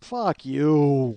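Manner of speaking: mock-angry yell, drawn out; joking outburst. Worker pretending to refuse the neighbour's favour, mock-angry. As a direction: belt out a refusal you don't mean.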